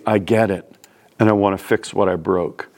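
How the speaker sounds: normal talking voice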